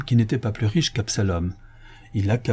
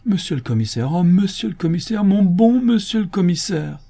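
plea building higher and louder; mounting, sighing begging to the commissioner; 9 semitones higher, 4 dB louder than usual. A narrator's reading. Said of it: "soupira Absalom". (supplication qui monte en gémissant)

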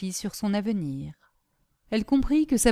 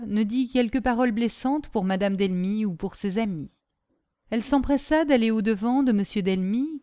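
plain reading voice

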